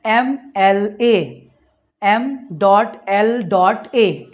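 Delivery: each letter very slowly and separately; slow spelled demo. Shown as a demonstration. Teacher spells out the abbreviation slowly for the class. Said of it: "इसका sound सुनना है".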